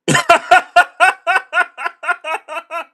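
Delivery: loud, high helpless laughter; long high laugh. Real, not acted. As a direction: crack up at the phrasing. Laughs at the guest's phrase.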